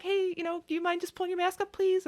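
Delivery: high-pitched, quick, polite request; sweet coworker. Retold or imitated. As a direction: imitate a very nice coworker asking gently. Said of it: "my sweetest coworker, like, the nicest woman alive".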